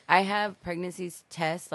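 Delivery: usual speaking voice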